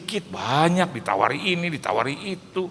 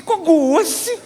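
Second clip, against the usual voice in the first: high, loud laughing protest; indignant protest; 12 semitones higher, 6 dB louder than usual. Retold or imitated. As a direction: defend his own seat at home. Father protesting to children. (protes keras sambil tertawa)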